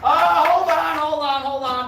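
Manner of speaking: loud and high, excited. Real, not acted. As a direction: stop them, thrilled. Excited stop after correct guess.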